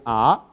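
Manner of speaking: ah rising upward; second tone. Shown as a demonstration. Teacher showing Mandarin second tone, rising ah. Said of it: "is rising".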